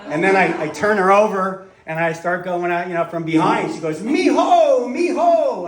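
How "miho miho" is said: high, loud falsetto moan; hammed-up high female moan; mock moaning woman. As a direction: squeal it like the woman in bed.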